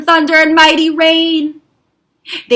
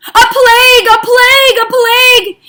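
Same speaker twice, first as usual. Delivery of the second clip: high, loud wailing cry of doom; dramatic cry; 11 semitones higher, 9 dB louder than usual. Acted. Storyteller crying out the plague refrain dramatically. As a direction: cry out the plague like a warning bell.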